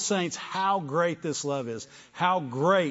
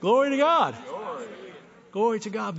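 loud, high burst of praise; exultant cry of praise; joyful praise; 6 semitones higher, 4 dB louder than usual. Real, not acted. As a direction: shout it out in delight.

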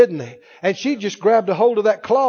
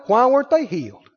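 usual speaking voice

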